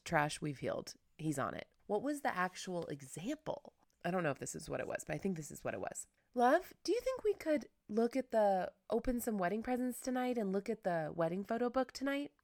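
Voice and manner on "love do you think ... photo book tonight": higher, sweet asking voice; pleading ask tone. Shown as a demonstration. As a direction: coax him gently, a bit higher. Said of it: "I change my tone of voice".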